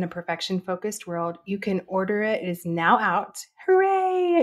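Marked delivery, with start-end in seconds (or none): 3.7-4.4 high, bright little cheer; excited cheer. Real, not acted. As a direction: celebrate the news. Announcing her book is out.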